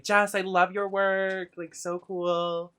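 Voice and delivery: high, drawn-out imitation of a gushing fan; fangirling mom. Streamer imitating mom fangirling over Jess in chat, gushing. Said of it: "She's, like, fangirling".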